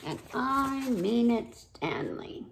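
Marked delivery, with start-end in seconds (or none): none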